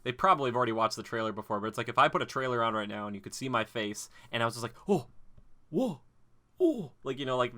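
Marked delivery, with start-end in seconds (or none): 4.9-6.9 high mock-amazed "oh, whoa"; mock reaction noises. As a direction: imitate an over-the-top gasping reactor. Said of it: "just making weird noises".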